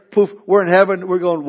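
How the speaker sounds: ordinary talk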